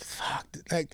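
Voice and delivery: unvoiced disbelieving whisper; mock whispered exasperation; whispered aside. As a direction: breathe it out under your breath. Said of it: "the dude looks at her like".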